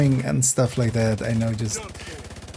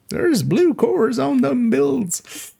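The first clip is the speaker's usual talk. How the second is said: put-on high, slow cackly old timer; wheezy old miner drawl; old prospector voice; 13 semitones higher, much slower than usual. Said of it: "we went with the old prospector voice".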